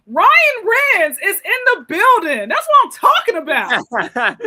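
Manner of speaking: loud, high-pitched guest introduction; hype announcer. Acted. Host bringing Ryan Renz to the stage, hype announcer. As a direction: announce them big, like bringing on a headliner.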